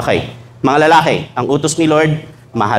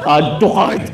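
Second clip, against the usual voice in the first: laughing shopper; slower than usual. Acted. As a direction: giggly add to cart. Mimicking wife shopping a sale.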